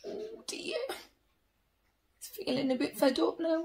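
ordinary delivery, nothing marked

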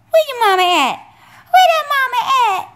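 high, loud, laughing sing-song at the dog. Real, not acted. Owner teasing her dog about mama, high excited baby talk. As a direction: tease the puppy, voice climbing up into a laugh.

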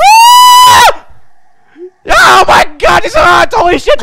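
high, loud excited scream; thrilled shriek. Real, not acted. Streamer squealing over Hornet's voice, high and loud. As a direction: squeal with delight at the reveal.

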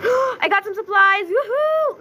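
high, loud joyful cheer; delighted cheer. Real, not acted. Gamer cheering at finding new supplies. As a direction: celebrate the find out loud.

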